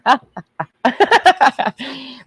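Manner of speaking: high bursting laugh. Shown as a demonstration. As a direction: let out a joyful laugh. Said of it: "I laugh when I'm fucking".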